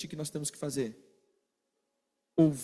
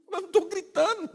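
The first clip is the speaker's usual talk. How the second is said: mock denial; 12 semitones higher than usual. Acted quarrel, denying shouting.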